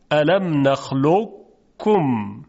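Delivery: الم نخلقکم slow, ق heaviness heard before کم; partial merger demo. Shown as a demonstration. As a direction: recite الم نخلقکم slowly, thick ق into the ک.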